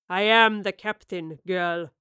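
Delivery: put-on stern captain puts the girl in her place; deep weathered captain. A narrator's reading. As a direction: let each word land like a weathered captain. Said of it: "he replied in a deep voice as weathered as the ship".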